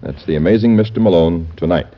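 ordinary talk

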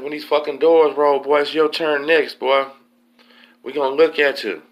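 high, loud jailhouse threat; imitated threatening yell. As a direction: holler a threat through the vent, imitating an inmate. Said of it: "who yelled it at that moment".

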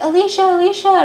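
high, pleading cry of her name; mock crying. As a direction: wail her name, imitating tears. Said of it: "Gabriel's crying".

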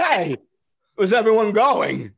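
high, loud puzzled call; hammed-up bewildered Walken. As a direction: call after the fleeing crew.

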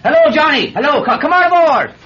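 loud, high hail; calling out. Acted. Truck driver calls Johnny over to the truck, hailing loudly. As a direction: holler to a friend some way off. Calls across to waiting friend.

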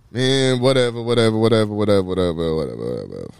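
repeated brush-off sinking steadily in pitch; droning, descending dismissal; dismissive. Retold or imitated. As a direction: tune someone out, trailing down low and fading. Acts brushing off the talkative stranger.

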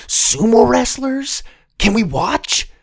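put-on high, eager kid's voice; excited child. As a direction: gasp it out in delight. Kid thrilled by sumo wrestlers.

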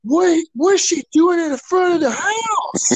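high, slow, hammed-up disbelief; badly faked surprise. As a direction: overact your shock like a terrible actor. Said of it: "acting was bad".

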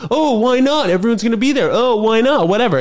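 high singsong nagging; mock pestering. Friends pressing someone anxious to come, mock pestering. Imitating people who pressure you.

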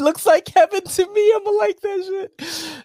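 high, loud squeal through laughter; cracking up. Real, not acted. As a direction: say it while helplessly laughing, voice shooting way up. Cracking up watching a video.

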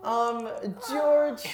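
high squealing anime voice; overacted anime dub. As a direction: pitch it up like an anime character. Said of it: "In an anime voice".

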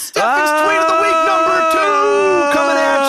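high, drawn-out hype yell; shouted announcement. Pretend. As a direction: yell the segment intro like a showman. Shouted jingle intro.

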